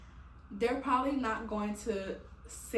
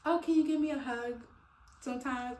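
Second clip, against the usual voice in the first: higher, louder, sweetly begging; pleading for a hug; 4 semitones higher, 4 dB louder than usual. Retold or imitated. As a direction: ask like a kid wanting a cuddle. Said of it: "my peoples and be like".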